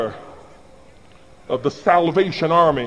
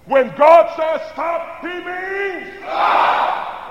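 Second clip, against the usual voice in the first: slow, stretched shout, much higher; booming call; 9 semitones higher, much slower than usual. Shown as a demonstration. Preacher leading the congregation in a shouted refrain, booming. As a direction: boom out stop like a command. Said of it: "can you do better than this side".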